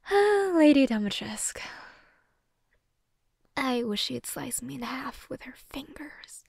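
sighing, whispered swoon; dreamy whisper. Acted. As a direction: sigh the name, then whisper the wish slowly. Swooning over a fantasy woman.

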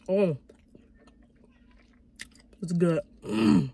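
pausing to chew food; talking while chewing. Real, not acted. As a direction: mouth partly full. Seen 0: lips closed and working between words; wing held, dipped in sauce.

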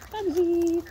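high sing-song call; calling the bird. Real, not acted. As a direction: lift the name up high to call.

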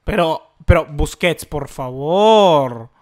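loud, drawn-out plea at the screen; exasperated loud plea; frustrated plea. Real, not acted. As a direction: beg the player in exasperation. Frustrated pleading at player.

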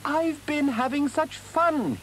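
very high, slow, delighted Mole; gleeful boast. Acted. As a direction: announce it high, drawing out your delight. Mole tumbles in very pleased with himself.

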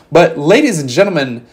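booming, slow hype-man announcement; hype announcer. Acted. Hyping the tournament result like announcer.

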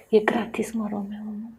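whisper. Real, not acted. Spune un secret.

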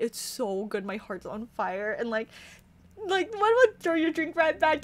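ordinary delivery

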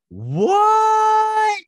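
piercing, loud mock shriek; hammed-up squeal of disbelief; mock shock. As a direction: stretch it into a very high scream. Acting out her second shock.

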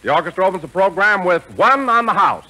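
ordinary delivery

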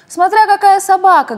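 высоко и громко «Смотря какая собака»; крикни звонко издалека; звонкий крик. A narrator's reading. Невидимый лягушонок кричит. (звонкий крик лягушонка)

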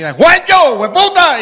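put-on very high, loud shout to an old friend; loud delighted yell; calling out. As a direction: holler across the street with joy. Friend calling out across street.

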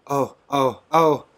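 high, loud rising ohs; ecstatic oh cries. A narrator's reading. A worshipper overcome, crying wordless ohs. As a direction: cry out in rapture without words. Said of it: "Joanna inarticulately testified".

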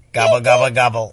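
playful turkey gobble. Acted. Parent acts turkey sound.

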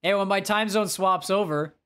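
ordinary delivery

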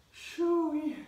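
high loud shoo-wee exhale; relieved whoop. Real, not acted. Relief after exercise.

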